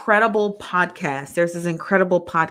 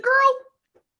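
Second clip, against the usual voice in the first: put-on high drawn-out sassy girl; lightly voiced commenter calling out; 7 dB louder than usual. Reading a viewer's comment, sassy high girl. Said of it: "She said".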